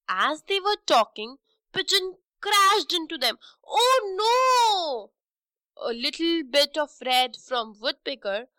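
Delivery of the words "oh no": high startled cry; surprised cry. A narrator's reading. Pigeon crashing into the birds, startled cry. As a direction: gasp it out as she crashes.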